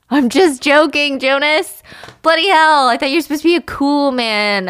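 loud, high-pitched mock rant; exasperated outburst. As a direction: blow up at a host who won't joke along. Acted drunken outburst at his host.

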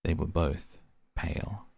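ordinary delivery

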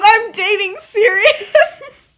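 high, loud, drawn-out blurt breaking into laughter; mock panicked confession. Flustered boy confessing who he is dating, mock panicked blurt. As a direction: blurt it out as if losing your mind. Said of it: "losing his mind".

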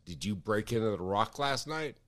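low, soft imitation of the accuser; a hint of a stern interrogator; accusing Jeff. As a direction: friend on phone, lightly voiced accusation. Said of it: "And he goes".

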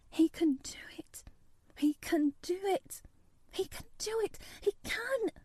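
high, breathy urgent whisper, climbing; under her breath. A narrator's reading. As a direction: gabble it to yourself in a rising whisper. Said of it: "she garbled over to herself under her breath".

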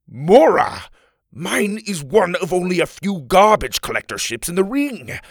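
loud, high furious scolding; an enraged father bellowing her name; angry father shout. Acted. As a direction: yell at the daughter who took the airship. Father scolds daughter angrily.

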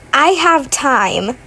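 usual speaking voice

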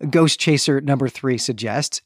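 ordinary talk

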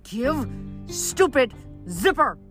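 high, slow, sputtering demand; exasperated. Acted. Leo wants his stolen zipper back, sputtering. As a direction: force out each word in outrage.

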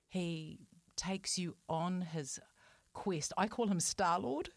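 ordinary delivery

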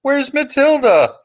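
put-on very high, loud, drawn-out child's yell; piercing little-kid shout in falsetto; squeaky little kid. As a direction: squeal it out like a small kid calling across a parking lot. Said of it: "with a squeaky little voice".

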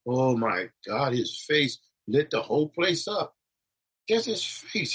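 normal talking voice

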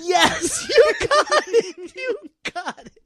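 high, gleeful laughing yes; excited cheer. Real, not acted. Host cheers the right guess.

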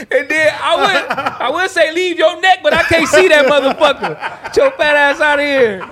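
high, loud laughing taunt; cracking up mid-insult; laughing while roasting. Real, not acted. Roasting his friend's neck, laughing loudly.

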